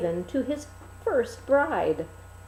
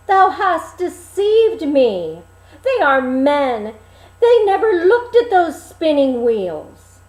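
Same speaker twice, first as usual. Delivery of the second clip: indignant king; 5 semitones higher, 8 dB louder than usual. Acted. King complains to the lion.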